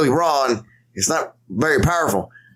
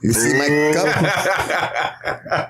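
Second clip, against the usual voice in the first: Bruce Lee; 2 semitones higher, much slower than usual. Retold or imitated. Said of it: "He's committing too hard to that".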